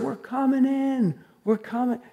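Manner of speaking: high, softened call from afar; imitated distant call. Preacher imitating the boatman calling to shore, high muted call. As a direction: call out high but held back, as if far off. Boatman answers across the water.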